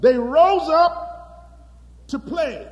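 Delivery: "They rose up to play" slow, high and loud on "rose"; emphatic slow repeat. Acted. Preacher repeats verse for emphasis.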